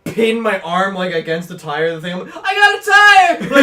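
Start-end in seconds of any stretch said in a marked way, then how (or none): 2.4-3.6 very high, loud, drawn-out yell; retold panicked shout. Storyteller reenacting his arm pinned by a tire, mock-panicked shout. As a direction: yell it like someone pinned and stuck.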